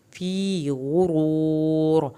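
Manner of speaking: فی drawn long, غرور with rounded lips and full r; slow chanted phrase. Shown as a demonstration. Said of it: "فی یا ساکن کو کھینچنا ہے".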